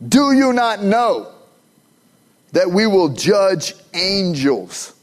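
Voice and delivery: a touch of preaching fire; slow emphatic reading. Acted. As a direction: build then drop at the end. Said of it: "uses it emphatically".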